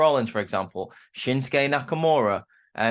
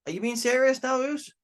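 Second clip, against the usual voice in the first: much higher, incredulous question to his brother; Jimmy scoffing at his brother, high and incredulous; mock incredulous Jimmy; 12 semitones higher than usual. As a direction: pitch it way up in disbelief, fading off on Uso. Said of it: "Jimmy's like".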